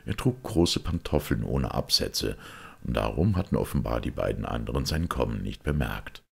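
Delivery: ordinary delivery, nothing marked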